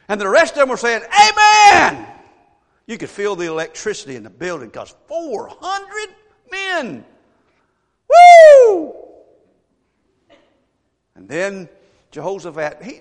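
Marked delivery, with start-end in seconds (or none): 1.0-2.1 loud, high mock amen; shouted amen. Crowd of prophets agreeing. 8.1-8.9 loud, high whoop; excited whoop. Retold or imitated. Excitement of the prophets.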